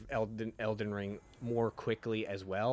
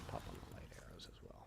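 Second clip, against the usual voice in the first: fast whisper to himself; quick breathy aside under his breath; 17 dB quieter, faster than usual. Real, not acted. Muttering to himself mid-game.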